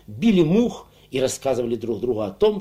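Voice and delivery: ordinary delivery, nothing marked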